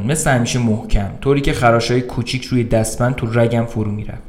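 plain reading voice

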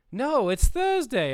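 high, loud, drawn-out correction; slow it down and pitch it up, insistent; cartoon wife correcting. Cartoon wife correcting her husband, high and emphatic.

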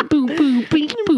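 high sing-song boo boo beep boo; Pablo Francisco bit. Retold or imitated. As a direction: chant it high like the comedian. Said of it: "Shout out to Pablo Francisco".

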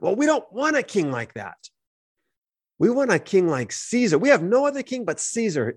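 high, insistent acted complaint; acted indignant demand, high and emphatic; protesting crowd.

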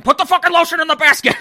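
hammed-up high, fast movie-killer yell; mock scream. Joker yelling at a lotion shopper, mock furious scream. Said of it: "scream at them".

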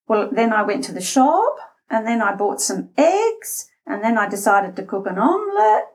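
every clause lifts at the end, on "shop", "eggs" and "omelette"; rising intonation. Acted. Interviewee presenting a boring list, rising on "shop", "eggs", "omelette". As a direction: make "shop", "eggs" and "omelette" each sound like a question. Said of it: "people will do it in an interview".

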